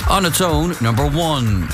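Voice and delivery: calling bingo numbers with pauses; bingo caller. Acted. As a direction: call each number, then repeat it.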